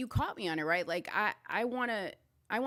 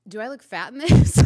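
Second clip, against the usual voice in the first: put-on much louder, sing-song girlfriend voice; playful girlfriend question; 4 semitones higher, 17 dB louder than usual. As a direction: ham up the fishing-for-compliments girlfriend. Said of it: "that you say".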